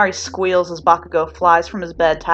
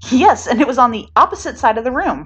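higher, louder butting in; eager interjection; 7 semitones higher, 7 dB louder than usual. A narrator's reading. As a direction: cut in brightly and loud. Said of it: "Mina interjects".

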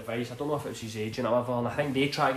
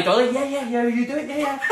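mock eager agreement; 11 semitones higher, 7 dB louder than usual. Mimicking Kudos eagerly accepting the offer.

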